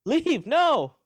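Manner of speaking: high, exasperated mock pleading; hammed-up pleading boss; pleading with the team. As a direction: beg them to leave, half-laughing. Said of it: "I'm like".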